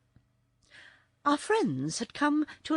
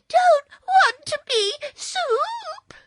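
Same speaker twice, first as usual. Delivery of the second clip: put-on high, loud child's wail; drawn-out tearful protest; starting to cry; 10 dB louder, slower than usual. A narrator's reading. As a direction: wail it slowly like a small boy in tears. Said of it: "beginning to cry".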